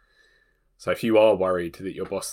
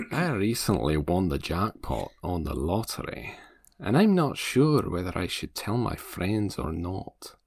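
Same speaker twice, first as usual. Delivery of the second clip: put-on a light, husky Scottish accent; sexy Scottish accent; 4 semitones lower than usual. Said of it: "You make this one a Scotsman".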